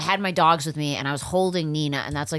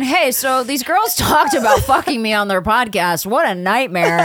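Brad Pitt impression; 6 semitones higher, 7 dB louder, slower than usual. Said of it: "Brad Pitt writes in".

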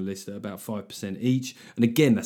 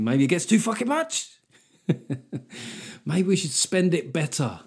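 higher-pitched indignant outburst; mock outrage; 6 semitones higher than usual. The rare voter on NHS funding, mock outrage. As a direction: blurt it as a comic protest. Mock public opinion, raised voice.

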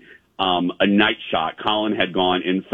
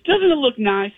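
high mock guide voice fishing for agreement; imitating Sally. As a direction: coax them to admire it, way up high. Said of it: "She goes".

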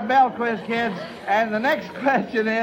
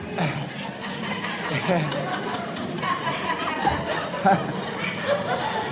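laugh; 4 dB quieter than usual. Real, not acted. Host laughs at Melvin's answer.

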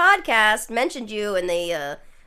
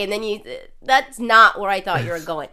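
ordinary talk

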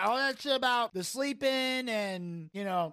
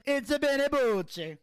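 slow hammed-up Italian accent; mock Italian accent; 6 semitones higher, 3 dB louder than usual. Imitating [name]'s Italian accent, slow and hammed-up. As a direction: drag it out like a cartoon Italian. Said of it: "he just goes".

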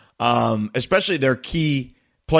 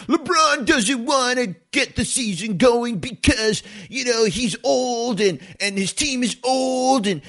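mock the online haters in a put-on whine; media troll voice; 9 semitones higher than usual. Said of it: "that's my best troll, media troll voice".

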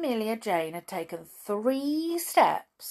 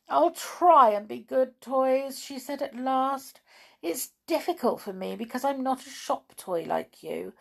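higher, a touch of meek sincerity; contrite promise; 6 semitones higher than usual. Acted. Amelia Jane promising the toys to be good, meek.

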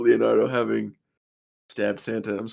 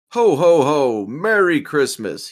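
loud, higher, slow ho ho ho; jolly Santa call; 6 semitones higher, 6 dB louder, slower than usual. Acted. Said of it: "he called out".

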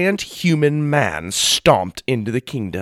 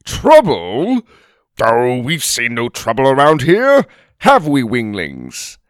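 innocent denial; 6 dB louder than usual. Acted. Bursley brushes off trouble.

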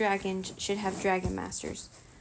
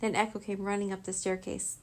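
plain reading voice

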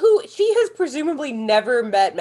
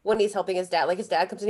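ordinary talk

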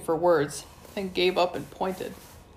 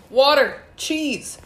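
loud, high desperate spell yells; screamed commands; 6 semitones higher, 6 dB louder, slower than usual. Acted. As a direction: scream each word in panic. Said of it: "Wayne screamed".

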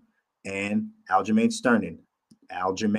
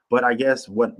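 usual speaking voice

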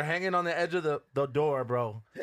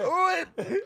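high pained "ooh"; 4 dB louder than usual. Retold or imitated. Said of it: "I was like".

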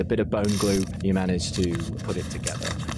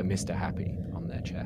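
ominous whisper; 4 semitones lower, 8 dB quieter than usual. A narrator's reading. Game master describing the cult emblem, eerie whisper. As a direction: whisper the name like a secret.

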